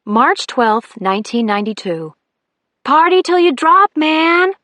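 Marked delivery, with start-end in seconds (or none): 2.8-4.5 put-on loud, higher whoop of a spring break party girl; party yell. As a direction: shout it out like you're already drunk on fun.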